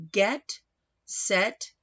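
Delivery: ordinary delivery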